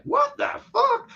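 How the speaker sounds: very high, slow, disbelieving reaction; incredulous. Retold or imitated. Reviewer retelling his reaction to a corny scene, high slow disbelief. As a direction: retell your own stunned disbelief. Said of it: "and I'm like".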